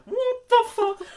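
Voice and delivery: high, loud mock outburst of shock; shocked yell. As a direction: shriek it as if shoved hard. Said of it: "and I was like".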